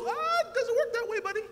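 high, laughing dean voice; laughing high mock dean; retold dean. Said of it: "He goes".

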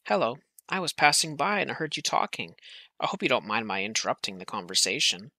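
ordinary delivery, nothing marked

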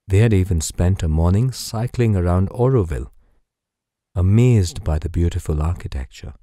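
plain reading voice

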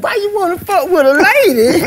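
high whiny mock plea; whining Teddy. Teddy Carpenter complaining to the police, in a high whiny mock plea. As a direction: whine it out to the cops.

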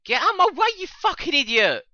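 high, loud yell of frustration; real exasperated outburst; angry shout. Real, not acted. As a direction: scream it at whoever is blocking you. Yells at player in game.

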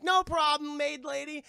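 put-on high, loud kid voices; chirpy boys. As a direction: pipe up brightly like little boys. Boys answer the maid.

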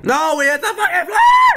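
very high, loud yelp with a laugh; shocked laughing shout. Real, not acted. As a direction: cry out in disbelief. Crashing into the flag.

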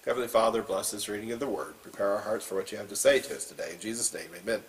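hushed opening prayer. Acted. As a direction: pray humbly over the reading. Opening prayer before sermon.

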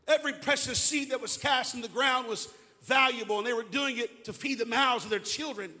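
ordinary talk

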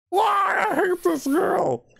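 real scared yell; frightened shout. Real, not acted. Doll keeps appearing, shouting.

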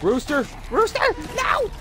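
high panicked yell; shouting. Real, not acted. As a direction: cry out to a teammate. Calling teammate as he falls.

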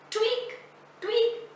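squeaky little tweak, rising; playful high sound effect; high soft tweak. Acted. Stick poking sound effect.

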